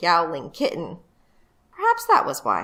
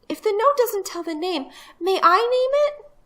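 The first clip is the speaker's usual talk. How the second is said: put-on high-pitched eager little girl; a hopeful little sister asking politely; young girl voice; 14 semitones higher than usual.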